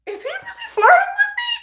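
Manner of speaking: very high, tiny squealed question; incredulous squeal. Retold or imitated. Dreamer wonders if he means it, incredulous squeal. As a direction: squeak it out in mock disbelief.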